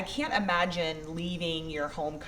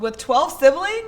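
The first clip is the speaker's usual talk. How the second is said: high, loud, slow disbelieving question; can't believe it, ask it back; incredulous question; 11 semitones higher, 12 dB louder, slower than usual. Real, not acted. Host doubting the best childhood with twelve siblings, incredulous.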